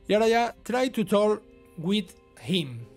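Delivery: normal talking voice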